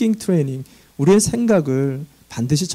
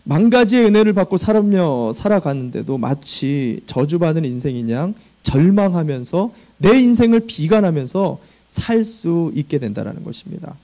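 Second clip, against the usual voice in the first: ordinary delivery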